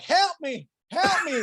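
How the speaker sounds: high, pleading mock panic; mock cry for help. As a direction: beg for rescue, hammed up. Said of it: "he's like".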